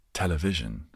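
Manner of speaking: ordinary talk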